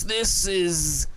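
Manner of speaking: slow, drawn-out mock-grand announcement; profound announcer voice. Shown as a demonstration. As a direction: intone it slowly with showy weight. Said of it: "some sort of voice".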